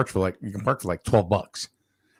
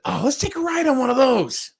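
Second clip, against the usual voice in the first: high-pitched eager suggestion; mock excitement; 4 dB louder than usual. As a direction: pitch it way up to sell a kid on a treat. Retold eager suggestion to child.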